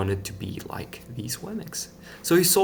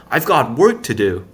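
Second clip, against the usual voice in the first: brushing off friends; 3 semitones higher, 9 dB louder than usual. A narrator's reading. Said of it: "he would tell them".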